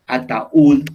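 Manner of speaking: "Ataúd" broken up as a-ta-ÚD, loud final beat; teacher sounding out "Ataúd" with a punched ending; stress on last syllable. Shown as a demonstration. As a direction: hit the "úd" of "Ataúd" high and loud. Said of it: "el golpe lo estamos dando en la última sílaba".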